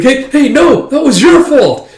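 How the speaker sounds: high, loud, drawn-out blame; acted squabbling yell; defensive protest. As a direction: push the blame back indignantly. Brothers quarrel over broken bowls.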